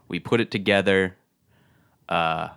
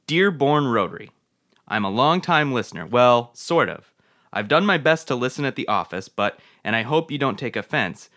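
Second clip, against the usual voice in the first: higher, projected letter-reading voice; reading a letter aloud; 4 semitones higher, 3 dB louder than usual. Acted. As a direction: read it out like a formal announcement. Reads a fan letter aloud.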